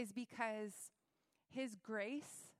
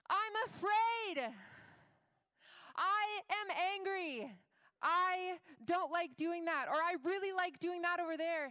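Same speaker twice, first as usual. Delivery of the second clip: bold declaration; 7 semitones higher, 7 dB louder than usual. Pretend. Preacher voices a believer boasting weakness, loudly proclaiming. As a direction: declare it boldly to the room.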